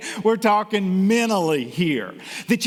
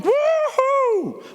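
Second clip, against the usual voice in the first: high, loud whooping cheer with a North Carolina twang; mock North Carolina cheer; 5 dB louder than usual. Speaker imitates a circus crowd, a high loud North Carolina whoop. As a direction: whoop it like a fan in the circus stands. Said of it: "Maybe not with that North Carolina accent".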